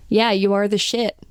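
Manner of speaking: put-on American accent; Australian trying out an American accent. Shown as a demonstration. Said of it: "say it in an American accent".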